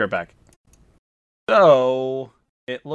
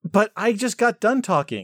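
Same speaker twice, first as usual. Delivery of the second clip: whiny protest; 10 semitones higher, 5 dB louder, slower than usual. Acted.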